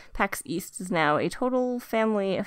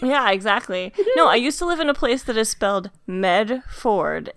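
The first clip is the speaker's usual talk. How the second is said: ordinary delivery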